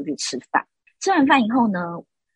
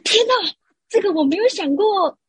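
much higher, astonished aside; stunned inner thought; 11 semitones higher, 4 dB louder than usual. Retold or imitated. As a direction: gasp it out in disbelief. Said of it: "然后我想说". (高音惊讶的内心独白)

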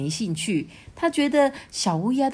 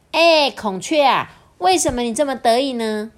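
curious little crow; 5 semitones higher, 5 dB louder than usual. A narrator's reading. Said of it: "小乌鸦就很好奇的问孔雀说". (好奇地慢慢问)

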